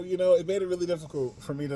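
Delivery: ordinary talk, nothing marked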